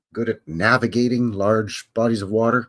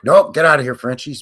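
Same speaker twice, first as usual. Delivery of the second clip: hammed-up loud brush-off; mock dismissal; 3 semitones higher, 8 dB louder than usual. New Yorkers turning away the Acadians, mock loud brush-off. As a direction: shoo them off loudly.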